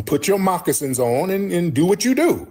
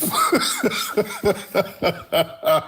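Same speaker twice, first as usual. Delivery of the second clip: long helpless laugh; real laugh; 4 semitones higher than usual. Real, not acted. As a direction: burst out laughing at the nonsense. Laughing at caller babble.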